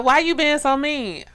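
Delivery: high, loud mock Haitian suitor; imitated Haitian accent. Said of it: "He was talking to his accent".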